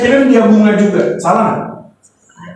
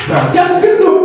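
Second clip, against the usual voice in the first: wife's retort; 7 semitones higher than usual. Retold or imitated. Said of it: "Lalu istri ngomong".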